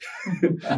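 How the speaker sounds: short high-pitched laugh; amused laugh. Real, not acted. Laughing after retelling her complaint to Jesus, amused. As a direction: let out a quick, high laugh.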